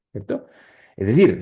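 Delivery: usual speaking voice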